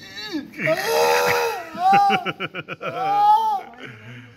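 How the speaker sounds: loud squealing laughter, high and strong; high shrieking laugh. Real, not acted. Vlogger cracks up at his friend, shrieking laugh.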